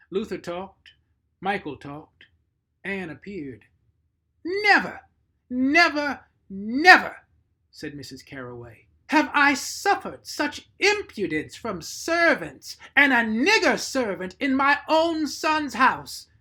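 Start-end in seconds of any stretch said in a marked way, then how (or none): 4.5-7.3 much higher and much louder, each never hammered; outraged cry. Acted. Outraged mistress scolds servant. 9.1-16.3 high, loud and slow, a voice apart; indignant outburst. Acted. Outraged mistress scolds servant.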